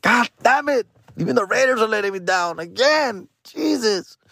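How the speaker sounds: high, wailing mock exasperation; hammed-up high-pitched complaint; exasperated cursing. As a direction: lament being let down. Curses team for losing.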